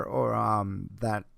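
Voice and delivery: usual speaking voice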